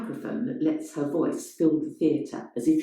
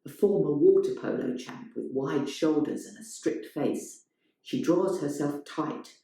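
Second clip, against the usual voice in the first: ordinary delivery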